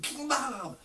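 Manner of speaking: put-on boy in children's church shouting King Bob; kid's King Bob. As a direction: yelp it like the little boy did. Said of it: "And he was like".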